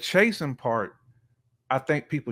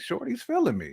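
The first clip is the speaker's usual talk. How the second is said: much higher, playful guy voice; imitating a smitten guy; 13 semitones higher than usual. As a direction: play a dude who is into her. Imitating a man's inner thoughts.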